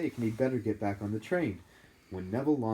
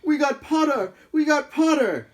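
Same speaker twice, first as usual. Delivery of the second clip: much higher, loud gleeful yell; twins yelling; 12 dB louder than usual. Acted. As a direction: chant it triumphantly across the table. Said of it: "the Weasley twins yelled".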